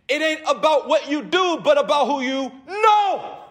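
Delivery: "about" and "know" hit hard, loud and high; shouted emphasis on "know"; emphatic preaching. Acted. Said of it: "Notice the emphasis".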